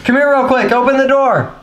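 loud, urgent calling. Real, not acted. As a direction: shout it through the door. Calling friend in the shower.

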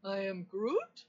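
questioning Groot, rising sharply on the last word; Groot impression. Shown as a demonstration. Assistant doing another requested impression, questioning Groot voice. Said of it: "Do another impression".

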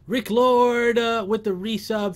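excited shoutout. Real, not acted.

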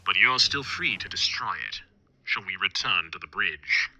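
lightly voiced ominous stranger; slow, measured and cold; slow stranger's voice. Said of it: "said the stranger's voice slowly".

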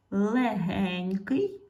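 very slow "Легенький"; slow drill word; drawn-out check word. Shown as a demonstration. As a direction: stretch "Легенький" out slowly. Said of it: "Перевіряємо".

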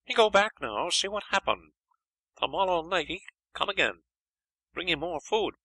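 a friendly foreign guide making promises in a high voice; Chinese helper. A narrator's reading. Helper speaks pidgin English.

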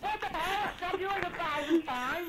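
loud indignant protest; exasperated outburst. Real, not acted. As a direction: yell it in disbelief. Outraged at losing fight.

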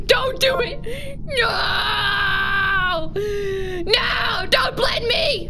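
high, slow hammed-up wailing plea; begging not to be blended, hammed-up; mock panicked cry. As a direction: cry out in fake terror, drawn out. Character pleading in game.

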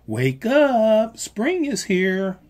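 high, loud wake-up call; cheerful high call; calling in chorus. A narrator's reading. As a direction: sing it out like a chorus of froglets. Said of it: "called the froglets in choirs".

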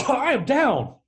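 rally them to your side; mock rallying cry of total agreement; aggressively agreeing. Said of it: "it's aggressively agreeing with them".

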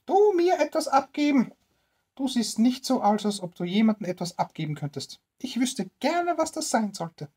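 put-on high, scornful old woman voice; suspicious old woman. Old woman doubting what the poor tramp could give, high and scornful. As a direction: sneer at the tramp as a curious old crone, high.

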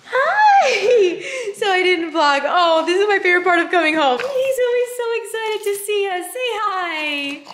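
excited pet voice. Real, not acted. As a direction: gush over the happy dog. Greeting excited pet at home.

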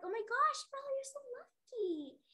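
high, stretched-out gushing; impressed, sing-song high exclaiming; gushing amazement. Acted. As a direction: gush it in a high voice. Parents gushing to Bella.

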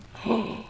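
sharp high intake of breath; surprised gasp. Real, not acted. Sudden discovery.

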